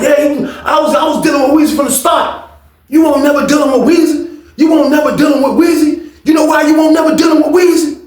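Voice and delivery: high-pitched, insistent confrontation; heated retold argument. [name] in his rival's face over [name], heated and high. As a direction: argue it high, word by word.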